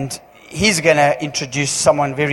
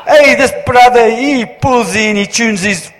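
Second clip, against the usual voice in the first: put-on loud, high Indian English accent through laughter; Indian dialect laughing; 5 semitones higher, 6 dB louder than usual. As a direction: carry on the imitation while laughing. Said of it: "but in the Indian dialect".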